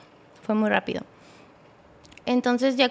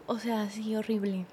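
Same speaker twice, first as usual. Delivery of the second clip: usual speaking voice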